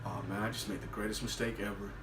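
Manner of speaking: low, very soft inner voice; hushed mock regret, far below normal volume; instant regret. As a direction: mutter it to yourself with dread. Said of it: "You can see the instant regret".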